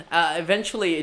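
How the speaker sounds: ordinary delivery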